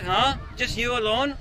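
normal talking voice

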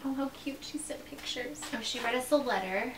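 soft unvoiced whisper. Real, not acted. Whispering over the package contents. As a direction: whisper it to herself. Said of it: "unvoiced whisper".

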